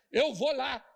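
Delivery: aunt declaring she'll handle it; firm aunt. Retold or imitated. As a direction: announce it with decision. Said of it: "minha tia falou".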